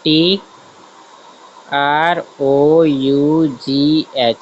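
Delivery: each letter drawn out while writing it on the board; spelling it out slowly. Shown as a demonstration. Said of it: "दोबारा लिख देता हूँ". (Board पर लिखते हुए हर अक्षर धीरे-धीरे खींचकर बोलना)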